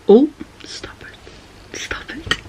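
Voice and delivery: breathless whisper over a cute scene; whispered stop it; whispered squeal. Real, not acted. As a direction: hush it out, overwhelmed by cuteness.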